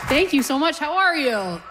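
loud, high stage greeting; calling to the crowd. Real, not acted. Comic greets a cheering audience, calling out loudly. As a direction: project over the cheers.

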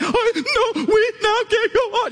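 very high squealed sobbing, words barely formed; hammed-up crying jag; mock bawling. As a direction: wail it as if too tearful to talk. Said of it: "can't even talk type crying".

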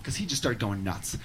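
ordinary talk, nothing marked

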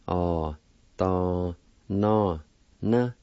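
ah, da, na, na said one syllable at a time. Shown as a demonstration. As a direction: tap out ah da na na separately. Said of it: "Second part".

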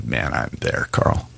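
whispered low, barely voiced; a listener's hushed confession in the car. Whispering to himself. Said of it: "and you go".

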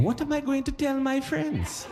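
high, soft panicky inner voice; Bob's worried thought. Retold or imitated. Bob stuck on toilet, high soft fretting. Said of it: "'Cause he was thinking".

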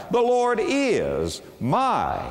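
high and slow, "my" set apart after a pause; stress on MY. Shown as a demonstration. Preacher moving to the next word, stress on "my". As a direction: stretch "is", pause, then land on "my". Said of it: "talk a long time about that little word".

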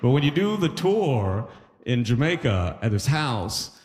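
usual speaking voice

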